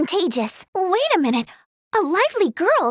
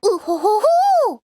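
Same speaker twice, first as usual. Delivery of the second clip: high, loud "ooh-hoo-hoo"; rising, drawn-out teasing hoot; 3 semitones higher, 5 dB louder than usual. Acted. Teasing on finding two chatting.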